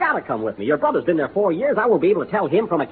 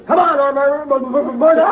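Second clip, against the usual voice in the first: excited stammer; 8 semitones higher, 4 dB louder than usual. Acted. Stammering over her name.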